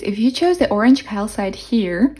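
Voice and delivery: usual speaking voice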